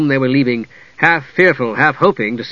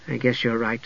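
reluctant soft voice; 2 semitones lower, 9 dB quieter than usual. A narrator's reading. Usha reluctantly agrees.

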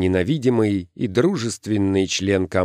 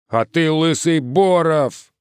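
high, slow jeer; hurled insult; 8 semitones higher, 4 dB louder, slower than usual. A narrator's reading. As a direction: stretch out the insult mockingly. Ответное оскорбление в перебранке.

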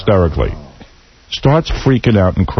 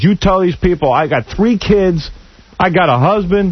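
mock scream; 9 semitones higher than usual. Sister screaming at her brother on air, high-pitched mock scream. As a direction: shriek her words up high like she's losing it. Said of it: "she's screaming at him".

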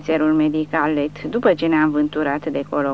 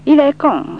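French curse; 10 semitones higher, 6 dB louder than usual. Acted.

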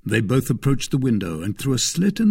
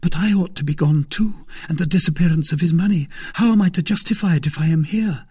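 high, quick, flustered woman's voice; anxious young woman; 7 semitones higher, 4 dB louder, faster than usual. Acted. Woman afraid to face her husband, flustered and anxious. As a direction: fret rapidly, pitched up.

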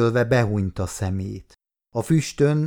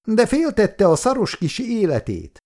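high-pitched accusing outburst; angry shout; 11 semitones higher than usual. A narrator's reading. Bereaved mother accuses the man of saving himself, shouting angrily. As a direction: lash out in a much higher voice. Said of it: "kiáltott rá a nő".

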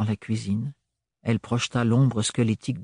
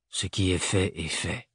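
low, softer, matter-of-fact delivery; detached and subdued; neutral tone; 5 semitones lower, 6 dB quieter than usual. A narrator's reading. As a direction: state it without any feeling, pitched down. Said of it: "d'un ton neutre".

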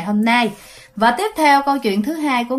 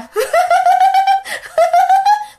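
shrill ghostly cackle, far higher than the voice; eerie high laugh; 4 dB louder than usual. Retold or imitated. Teller imitating the possessed woman's ghostly cackle. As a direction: let out a long spooky ghost laugh.

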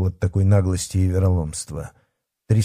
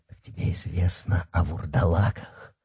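softly probing werewolf; intrigued menace; 3 semitones lower, 7 dB quieter than usual. A narrator's reading. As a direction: ask softly, with quiet menace.

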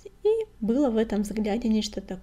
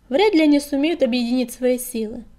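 louder call from the crowd; 3 semitones higher, 8 dB louder than usual. A narrator's reading. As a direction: shout it out to the meeting. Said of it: "крикнул Крутобок". (громкий выкрик)

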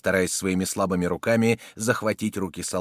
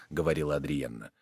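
ordinary delivery

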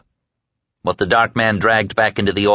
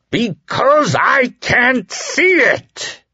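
high, slow, roaring; roared; much slower than usual. A narrator's reading. Dark man roaring at the woman he grips, enraged. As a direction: bellow it out, drawn and high. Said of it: "he roared".

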